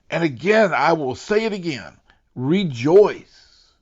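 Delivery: high, loud, ringing preacher's cry; preaching at full force. Acted. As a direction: lift the congregation, calling them to rejoice. Preacher builds to a climax.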